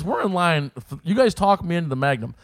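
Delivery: ordinary talk, nothing marked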